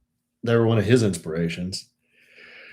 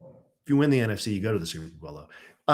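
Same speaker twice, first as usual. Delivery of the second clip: normal talking voice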